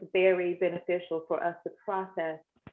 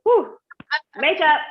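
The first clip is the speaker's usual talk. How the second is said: loud high whew; emotional exhale; 6 semitones higher, 8 dB louder than usual. Real, not acted. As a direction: blow out the emotion. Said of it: "get myself under control".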